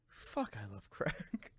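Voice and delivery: low, slow flat murmur; low deadpan mutter. Retold or imitated. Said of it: "Same thing, like".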